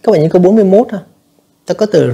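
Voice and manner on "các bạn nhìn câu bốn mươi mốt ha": ordinary talk, nothing marked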